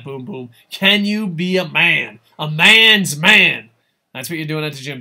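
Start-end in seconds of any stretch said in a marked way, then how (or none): 0.7-3.7 loud, slow, drawn-out dare; hammed-up challenge. Real, not acted. Streamer daring a viewer before the gym, loud hammed-up challenge. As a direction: stretch MAN out in a big mock challenge.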